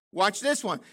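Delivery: ordinary talk, nothing marked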